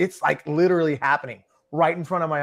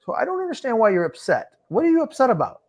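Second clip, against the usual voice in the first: pitched-up, puzzled "what are you upset about"; baffled question; 10 semitones higher, 3 dB louder than usual. Retold or imitated. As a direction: sound honestly baffled. Retold puzzled question to winner.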